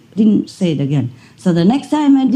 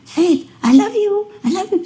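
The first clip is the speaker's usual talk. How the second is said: much higher coaxing pet voice; coaxing the dog; 13 semitones higher than usual. Retold or imitated. Owner coaxing her dog to say it, high and sweet. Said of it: "I keep trying to encourage her".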